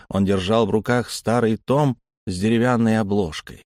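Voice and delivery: plain reading voice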